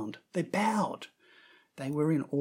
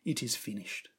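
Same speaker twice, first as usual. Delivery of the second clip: softer, fading last words from the cross; quiet last words; 5 semitones lower, 9 dB quieter than usual. Acted. Dying Jesus on the cross, softer and fading. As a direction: let it fall away, drained. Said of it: "Jesus' last words from the cross were".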